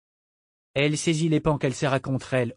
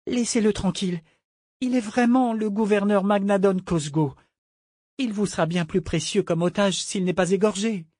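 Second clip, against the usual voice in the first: high-pitched, earnest female character; pleading young woman; 8 semitones higher than usual. Acted. Malta bargaining for the governor's life, earnest plea.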